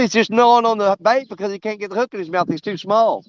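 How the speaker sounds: ordinary talk, nothing marked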